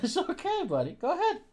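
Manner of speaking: high, soft cooing reassurance; gentle pet talk. Real, not acted. Owner lets the dog go have fun, high soft cooing. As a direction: kindly send the pup off in a tiny high voice.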